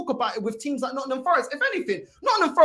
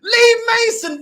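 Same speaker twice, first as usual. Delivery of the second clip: high, loud cry of disbelief; exasperated outburst; 7 semitones higher, 9 dB louder, slower than usual. Real, not acted. Fan fed up with referee excuses, exasperated. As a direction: shout the name in exasperation.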